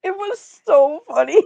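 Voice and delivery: high, laughing through the words. Real, not acted. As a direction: say it while still laughing. Laughing about the memory.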